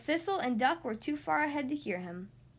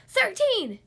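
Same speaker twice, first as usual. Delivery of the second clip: high, loud shouted answer; sudden blurted shout; triumphant yell; 9 semitones higher, 9 dB louder than usual. A narrator's reading. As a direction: yell it out before anyone else can. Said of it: "she yelled before Goose could answer".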